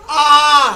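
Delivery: आ held long and loud as a dirgha vowel; a teacher demonstrating the long vowel आ; long aa demo. Shown as a demonstration. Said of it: "जैसे देख ले".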